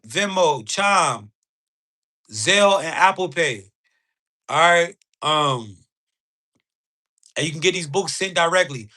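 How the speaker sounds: ordinary talk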